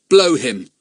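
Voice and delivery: ordinary delivery, nothing marked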